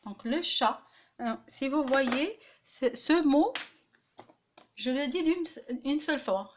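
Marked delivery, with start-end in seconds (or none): none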